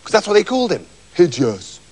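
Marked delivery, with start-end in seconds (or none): none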